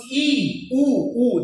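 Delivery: ई and ऊ pushed out with a bit of force; vowel demo with force. Shown as a demonstration. Teacher drilling the vowels for pupils, with a bit of force. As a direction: press a little on ई and ऊ. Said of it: "थोड़ा सा जोर देके बीच में रहता है ई".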